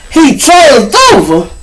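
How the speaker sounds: "He changed over" high, loud and stretched out; shocked disbelief. Retold or imitated. Echoing news of a friend's death, loud shocked disbelief.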